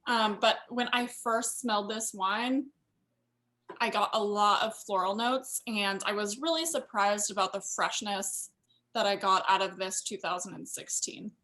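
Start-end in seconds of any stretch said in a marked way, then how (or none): none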